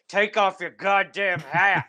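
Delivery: loud, slow, drawn-out mock bellow; retold outburst, each word stretched loud; furious old man. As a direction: bellow the order slowly, in imitation. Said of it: "And he got furious".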